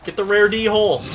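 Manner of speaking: loud, high eager call; excited shout. Real, not acted. Spots a rare animal.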